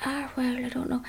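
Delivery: put-on soft, hesitant Irish-accented reply; Sam. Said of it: "And he said".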